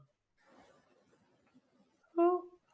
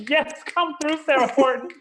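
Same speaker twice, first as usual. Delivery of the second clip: higher, bright cheering of yes; hyped cheer; 10 semitones higher, 4 dB louder than usual. Real, not acted. Host cheering Sarah on, higher and louder. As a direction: hype her up like a fan.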